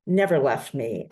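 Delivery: ordinary talk, nothing marked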